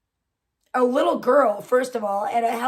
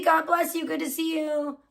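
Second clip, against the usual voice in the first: normal talking voice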